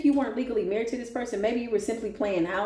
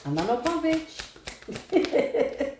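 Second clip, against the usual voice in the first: slow, defiant "I'm not no punk bitch"; defiant drawn-out boast; 6 semitones higher, much slower than usual. Real, not acted. Reader declares herself tough, slow and defiant. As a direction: stretch it out with swagger. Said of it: "defiant self-declaration".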